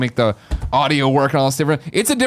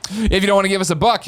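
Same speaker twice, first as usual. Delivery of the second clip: usual speaking voice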